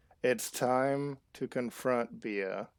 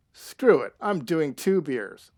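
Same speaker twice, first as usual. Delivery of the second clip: reckless declaration; 3 semitones higher, 7 dB louder than usual. Real, not acted. Decides to drink.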